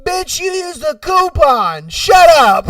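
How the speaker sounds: high, loud shout ending in a burst; mock outraged comeback. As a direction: clap back at full volume, amused. Imagined comeback to screaming customer.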